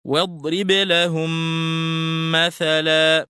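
لهم مثلا joined into one long humming m; labial merging. Shown as a demonstration. Teacher shows students the merging, لهم مثلا said with one drawn-out nasal m. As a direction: hold the merged meem nasally between لهم and مثلا. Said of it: "فتلفظ هكذا".

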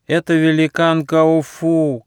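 slow, high-pitched cry of warning. A narrator's reading. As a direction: call out slowly like a hurt turtle. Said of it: "крикнула раненая черепаха".

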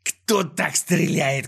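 slow, scornful villain holler; irritated yell. A narrator's reading. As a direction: stretch the words in exasperation. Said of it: "заорал с раздражением Клопс".

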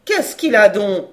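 loud, drawling fishwife question. Acted. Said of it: "fit-elle de sa voix traînarde". (voix traînarde, forte et étonnée)

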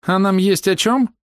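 high, slow sceptical question; high-pitched female query; high-voiced doubting woman. A narrator's reading. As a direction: ask doubtfully, high and drawn out. Hidden woman doubts talks.

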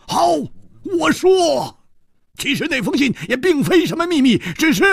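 high-pitched, fast, impassioned blurting; loud, desperate outburst. A narrator's reading. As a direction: blurt it out at full pitch, throwing caution away. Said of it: "大声地说". (不顾一切地高声喊出)

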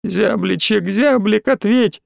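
high, thin plea rising in pitch; plaintive calling. A narrator's reading. Small boy calls silent friend through door, plaintive. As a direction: call for him imploringly. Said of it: "мелкий снова принялся звать".

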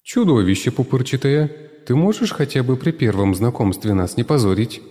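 tomato woman scolding the cucumber; lamenting tomato woman. A narrator's reading. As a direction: scold him like a fed-up lady. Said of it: "сокрушалась женщина-помидор".

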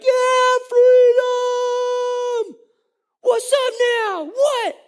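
very high, whooping celebration; excited. Acted. Freed Israelites on the far shore, high excited cheering. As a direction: crow in triumph with stretched high shouts. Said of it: "they're excited about what's going on".

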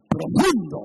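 loud, mocking Profundo; sarcastic. Real, not acted. Pastor mocking shallow chatter, loud and sarcastic. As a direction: sneer at shallow talk.

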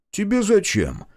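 wary, pitched high and pointed; suspicious. Acted. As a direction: ask it like you smell a rat. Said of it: "С подозрением покосился". (подозрительно, с высоким вопросом)

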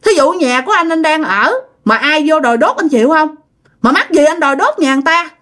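high-pitched scandalised remonstrance; acted indignant grandmother; protesting to stop him. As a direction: argue him out of it urgently. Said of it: "Cái bà ngoại mới cản".

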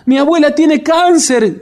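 high, loud, desperate cry of fear; desperate scream. Acted. Margarita discovers her grandmother's illness, screaming desperately. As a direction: shriek it as the worst news hits. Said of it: "gritó Margarita desesperada".

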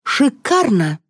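high-pitched, brisk exclamation; barked cheer. A narrator's reading. Liza approving Valentina's answer, barking. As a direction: bark out your approval. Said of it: "гаркнула Лиза".

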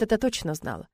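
ordinary delivery

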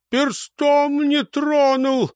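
high, slow, stretched-out denial; earnest self-exculpation. A narrator's reading. Accused man swears he never laid a finger, earnest protest. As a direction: plead innocence, drawing the words out high. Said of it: "усердно правился маяк".

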